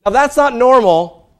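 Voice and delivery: loud mock parent order; loud emphatic point. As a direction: hammer it out to the room. Preaching emphasis.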